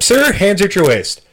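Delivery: mock cop bark, high and loud; stern cop order. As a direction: snap it out like an annoyed officer.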